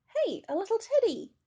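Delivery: high, loud delighted cry; excited child. A narrator's reading. Child finding Teddy in the wood, excited cry. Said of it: "cried one of the children".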